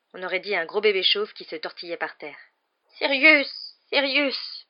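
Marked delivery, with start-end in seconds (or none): 3.0-4.7 high, loud tearful appeal; whimpering. A narrator's reading. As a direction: whine his name pleadingly. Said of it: "pleurnicha-t-il".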